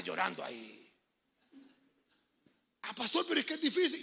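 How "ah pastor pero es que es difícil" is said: whiny churchgoer. Retold or imitated. Imita al feligrés quejoso.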